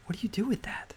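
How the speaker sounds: breathless whisper of wonder; awed whisper. Real, not acted. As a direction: hush it, overwhelmed. Overwhelmed by God's timing.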